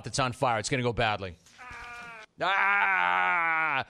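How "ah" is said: long, drawn-out mock scream of pain. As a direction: hold a hammed-up "ah", pitched higher. Acting out the arm on fire.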